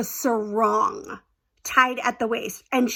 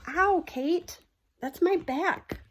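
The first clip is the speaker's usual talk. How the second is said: sudden real ouch; 7 semitones higher, 4 dB quieter, much slower than usual. Real, not acted. Pet jumps on her back.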